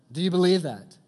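loud, high, demanding the answer. Shown as a demonstration. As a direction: call it out to the room. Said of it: "louder second ask".

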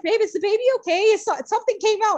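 high, fast, panicked question to her husband; panicked plea. Retold or imitated. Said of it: "I panicked for some reason".

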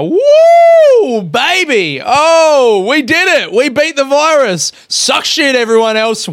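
very high, loud whooping victory yell; hammed-up gloating shout at the rest of the world; triumphant cheering. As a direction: celebrate like you just won the grand final. Gloating over Melbourne beating the virus.

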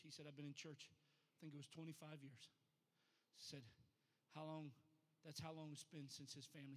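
soft, low storytelling; subdued, low-voiced pulpit anecdote; hushed testimony. Real, not acted. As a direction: tell it quietly after a big climax.

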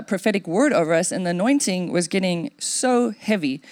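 usual speaking voice

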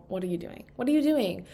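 normal talking voice

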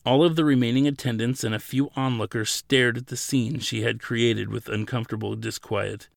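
plain reading voice

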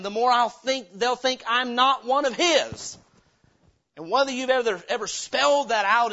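loud, high, driving preacher's cry; preaching at full force. Acted. Pastor crying out to the congregation, loud and high.

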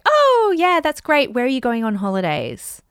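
high bright opener settling down; cheery deflection. Shown as a demonstration. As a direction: toss it off brightly and change the subject. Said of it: "so it could just be like".

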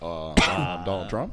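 very low, slow, soft impression; Donald Trump. Asked for a Trump impression among friends, low and slow. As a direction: drop the voice deep and drag it out. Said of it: "Do your trumpet".

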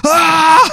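high, drawn-out attack scream, acted; mock war cry while lunging; stabber's yell. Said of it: "and he goes".

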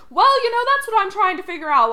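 high, loud put-on quote; Frasier. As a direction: imitate a sitcom character with a high, loud opening. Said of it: "when Frasier's like".

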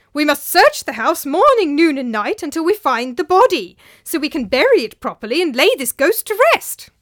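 rally everyone to the task; urgent command. Acted. Master resolves to find the body, loud and urgent.

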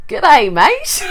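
loud, high mock Aussie greeting; mock Australian. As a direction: ham up a broad Australian g'day. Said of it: "this English girl's like".

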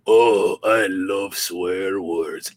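slow, gloating cousin voice; Boris voice. Retold or imitated. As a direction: drag out the love of swear words. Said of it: "Boris saying".

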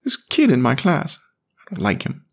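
put-on high, fast boyish complaint; hurt little boy. A narrator's reading. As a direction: tattle like a wounded kid. Jamie complains.

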